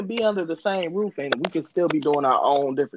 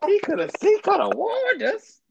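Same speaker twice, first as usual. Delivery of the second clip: mock complaining fans. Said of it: "Motherfuckers like".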